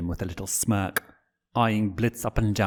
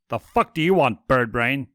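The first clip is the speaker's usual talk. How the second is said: loud, higher, irritated snap; 4 semitones higher, 4 dB louder than usual. Acted. Blitz snapping at unwelcome Stolas, loud and annoyed. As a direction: bite his head off.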